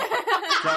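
sudden bright burst of laughter; real laugh; high laugh. Real, not acted. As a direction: laugh out loud. Laughing at Gilmore jab.

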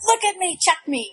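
high, urgent plea imitating her panic; hammed-up panicky demand; retold hysteria. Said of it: "I've not been so hysterical".

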